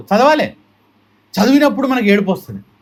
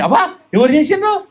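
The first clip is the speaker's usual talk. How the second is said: mock admiring praise; 8 semitones higher than usual. Devotees praising the decoration.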